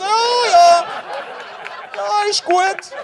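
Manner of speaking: loud, high Swiss German soldier shouting back; Swiss German accent. Acted. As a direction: bellow it like a cheery Swiss German grunt. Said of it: "He goes".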